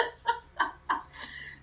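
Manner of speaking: high squeaky giggle; high laugh. Real, not acted. Laughs at own Daffy Duck remark.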